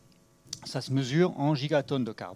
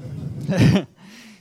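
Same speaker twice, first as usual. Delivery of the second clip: short laugh; 5 semitones higher, 9 dB louder than usual. Real, not acted. Laughs at his mic trouble.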